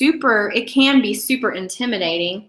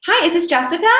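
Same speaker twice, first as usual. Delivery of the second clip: high, upbeat phone greeting; cheery sales-call opener; confident caller; 7 semitones higher, 3 dB louder than usual. Shown as a demonstration. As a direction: greet brightly, pitched well up. Demonstrates the polished phone greeting.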